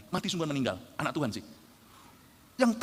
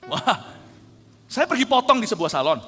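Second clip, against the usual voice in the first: ordinary delivery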